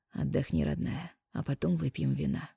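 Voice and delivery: soft tender whisper. A narrator's reading. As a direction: hush her gently, barely voiced.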